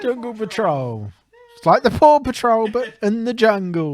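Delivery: high, sing-out mock presenter; kids' TV show. Co-host joining the kids' show bit, high mock cheer. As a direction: play along in a very high voice. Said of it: "Sounds like a kid's, like, like, T V show".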